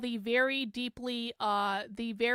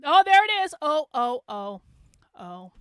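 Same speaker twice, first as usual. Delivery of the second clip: spotting it; 7 dB louder than usual. Real, not acted. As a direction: pounce on the find in triumph. Spots the slur in the image.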